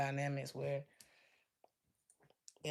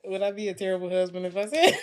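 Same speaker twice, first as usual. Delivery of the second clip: laughing; 3 semitones higher, 13 dB louder, faster than usual. Real, not acted. Joking about cooking both.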